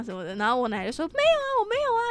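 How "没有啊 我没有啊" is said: 尖声高调学老奶奶否认; 拔高嗓门装成奶奶辩解; 模仿奶奶. Retold or imitated. 奶奶否认，学奶奶. (尖声模仿奶奶否认)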